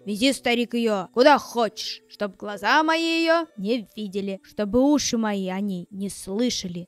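loud, harsh commanding shout; angry stepmother. Acted. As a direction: bark the order like a cruel stepmother. Stepmother orders old man away.

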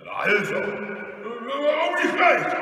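loud, slow, high robber voice; big villainous outburst; blustering robber. Acted. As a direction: swell into a drawn-out bellow. Robber grunts over his loot.

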